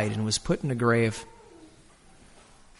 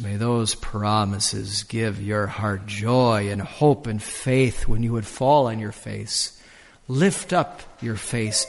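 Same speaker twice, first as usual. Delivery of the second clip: gentle benediction; 4 semitones lower than usual. Acted. Closing blessing of sermon.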